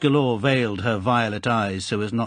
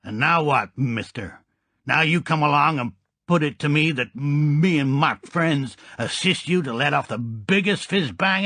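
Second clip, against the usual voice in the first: tough American gangster; 6 semitones higher than usual. A narrator's reading. Gangster answering Goldfinger, brash and raised.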